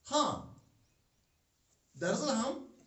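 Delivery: usual speaking voice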